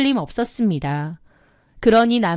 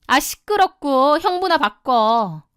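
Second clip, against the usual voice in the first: slow, higher, irritated order; brusque dismissal; 4 semitones higher, slower than usual. Acted. Younger sister demanding her brother-in-law on the phone, brusque. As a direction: brush her off impatiently, drawn out.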